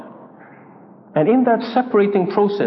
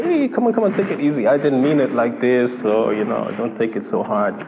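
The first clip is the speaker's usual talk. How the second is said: smooth it over, backing down; mock appeasing; 6 semitones lower than usual. We placate someone who misunderstood us, mock appeasing. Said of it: "we just say".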